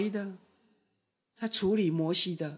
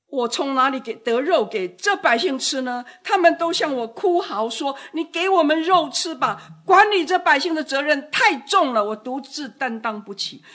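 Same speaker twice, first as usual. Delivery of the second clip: desperate plea; 6 semitones higher, 6 dB louder than usual. Retold or imitated. Voicing Moses' desperate prayer.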